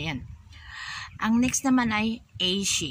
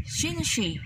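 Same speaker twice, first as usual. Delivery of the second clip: employer asking, high; 9 semitones higher than usual. Retold or imitated. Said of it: "tanongin ka ng madam mo".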